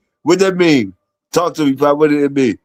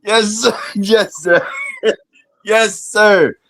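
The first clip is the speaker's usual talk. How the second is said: laughing; 5 semitones higher, much slower than usual. Real, not acted. Cheers the story, laughing.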